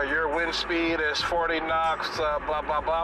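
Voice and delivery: slow, monotone pilot patter; captain announcement. Acted. Captain rattles off flight details, slow droning announcement. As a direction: drone it like a cockpit announcement. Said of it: "Something about".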